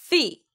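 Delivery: Fee said a bit stronger, held long; stressed Fee. Shown as a demonstration. Teacher models a stressed Fee for students. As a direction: lean harder on Fee. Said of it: "Fee를 좀 더 강하게 발음해요".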